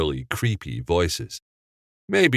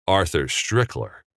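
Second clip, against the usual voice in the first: usual speaking voice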